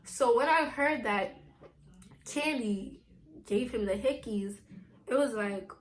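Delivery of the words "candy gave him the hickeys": normal talking voice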